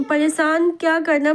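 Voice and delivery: normal talking voice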